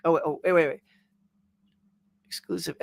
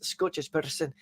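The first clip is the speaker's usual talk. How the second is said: soft try at a Scottish accent; 8 dB quieter than usual. Shown as a demonstration. Streamer warming up his accent for viewers, tentative Scottish accent. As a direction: feel your way into a rough Scottish accent. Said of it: "where I start to get my Scottish accent".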